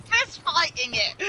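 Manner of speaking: high, loud and slow, giggling through the words; laughing retelling. Real, not acted. Telling how her brother punched the jaguar, laughing hard. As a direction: barely get it out for laughing.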